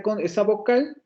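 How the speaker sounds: ordinary talk, nothing marked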